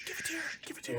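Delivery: urgent whispered grab. Acted. [name] swapping drinks behind the waiter, whispered. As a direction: hiss it so the waiter won't hear.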